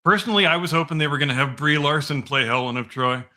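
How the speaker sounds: ordinary delivery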